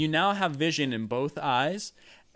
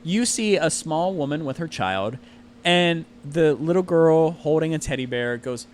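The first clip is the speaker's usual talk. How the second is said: normal talking voice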